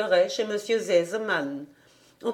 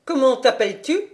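a touch higher, stern question; stern governess asking; 5 semitones higher, 5 dB louder than usual. A narrator's reading. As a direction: ask the child sharply, lightly voiced. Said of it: "demanda mademoiselle Rottenmeier".

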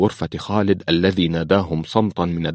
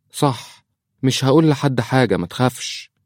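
put-on high child's voice; little girl; 7 semitones higher than usual. Daughter promises the priest her silence, child voice. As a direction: promise it in a small girl's high pitch.

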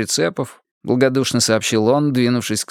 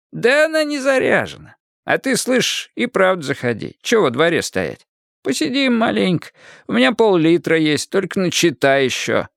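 lightly voiced hospitable old man; 8 semitones higher than usual. A narrator's reading. Watchman invites him in.

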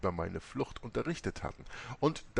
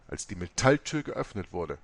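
ordinary delivery, nothing marked

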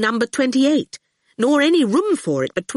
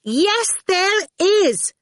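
put-on high, slow, offended little girl; indignant; 12 semitones higher, 3 dB louder, much slower than usual. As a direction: insist like an outraged child. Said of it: "Awful said indignantly".

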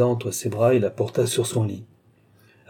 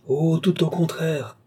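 higher, drawn-out retort; exultant cry; 6 semitones higher, slower than usual. A narrator's reading. Captive Lorenza finds her antagonist's weak spot, exultant cry. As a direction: cry it out slowly in triumph. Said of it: "s'écria Lorenza".

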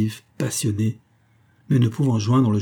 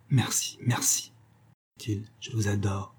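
soft, whispered thanks to a lover; hushed adoration; 7 dB quieter than usual. A narrator's reading. Secret declaration of love in church. (adoration chuchotée)

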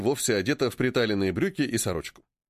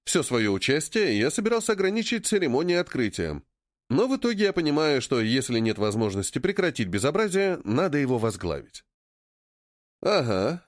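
plain reading voice

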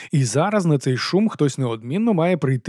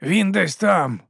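slow, raised, drawn-out opening; intoning; 5 semitones higher, much slower than usual. A narrator's reading. Nobby starts on the dragon's whereabouts, intoning slowly. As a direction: begin a tale in a high drawl. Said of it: "завів Нобі".

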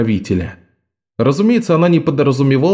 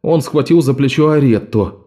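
usual speaking voice

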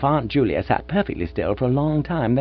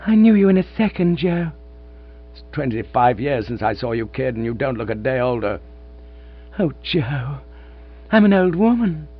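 giggly reunion; 4 semitones higher than usual. A narrator's reading. Reunited lovers, giggling aunt.

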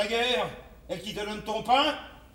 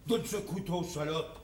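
low menacing order; 6 semitones lower, 7 dB quieter than usual. Acted. Ordre menaçant à voix basse.

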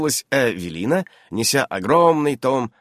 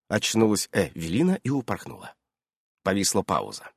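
ordinary delivery, nothing marked

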